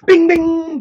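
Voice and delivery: loud, high, ringing bing; chime imitation. Shown as a demonstration. Demonstrating just like that, loud high chime. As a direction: sing out a loud bell sound. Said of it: "just like that".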